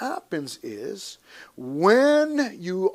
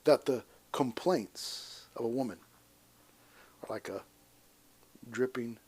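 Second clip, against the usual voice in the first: hushed aside; 5 semitones lower, 14 dB quieter than usual. Real, not acted. Teasing aside about Proverbs quote.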